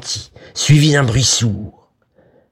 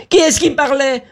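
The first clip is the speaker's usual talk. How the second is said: very high, alarmed woman's outcry; high-pitched frantic exclamation; crying out. A narrator's reading. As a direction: demand it in a high panicked voice. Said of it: "s'écria Vera".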